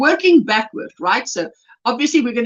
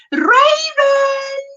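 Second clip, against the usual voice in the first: very excited, high drawn-out name call in a mock South African accent; Jen, excited South African greeting; 13 semitones higher than usual. Imitating a chat member greeting a friend, excited South African call. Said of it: "it was you saying very excitedly hi to Raven in your South African accent".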